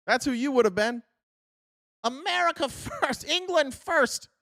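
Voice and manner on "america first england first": mock slogan.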